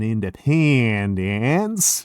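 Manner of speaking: slow, drawn-out mock Southern drawl; bad Southern accent. Mocking the movie vampire in a slow fake Southern accent. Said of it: "he goes like".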